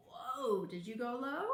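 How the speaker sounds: ordinary delivery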